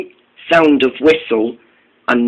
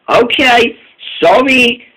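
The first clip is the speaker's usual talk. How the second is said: high mock-apologetic okay sorry; a sarcastic sorry, pitched up; 8 semitones higher than usual. A narrator's reading. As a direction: fake an apology, higher. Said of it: "said Caitlin sarcastically".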